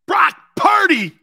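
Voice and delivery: high, loud, drawn-out mock announcer call; sarcastic big stadium announcement; mock hype shout. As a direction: oversell the name like a hype man. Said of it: "I can't even say that with a straight face".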